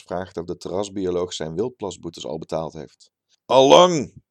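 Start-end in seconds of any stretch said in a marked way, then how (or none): 3.4-4.2 a high, loud, confident "Allang"; self-assured. A narrator's reading. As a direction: wave the doubt away with total assurance. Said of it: "bezwerend en zelfverzekerd".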